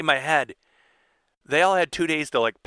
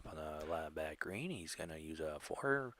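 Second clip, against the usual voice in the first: golf announcer voice; 6 semitones lower, 15 dB quieter than usual. Retold or imitated. Friend mimics a hushed golf announcer's putt call. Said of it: "That sounds like a golf announcer".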